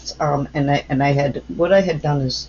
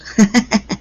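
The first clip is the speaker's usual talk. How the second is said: quick, louder burst of laughter; short, higher-pitched giggle; real chuckle; 6 semitones higher, 6 dB louder than usual. Real, not acted. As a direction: laugh at your own slip. Laughs after saying whoops.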